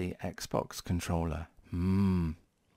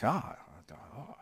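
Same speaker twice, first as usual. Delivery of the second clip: badly faked company impression with exclamations; terrible Sony impression; 4 dB quieter than usual. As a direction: do a clumsy, hammed-up impression. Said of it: "that's a terrible impression".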